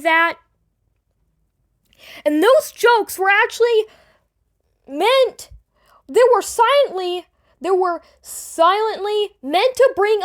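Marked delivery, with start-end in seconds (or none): none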